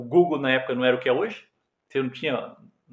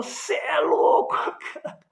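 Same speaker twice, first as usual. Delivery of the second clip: laughing disbelief; 12 semitones higher, slower than usual. Retold or imitated.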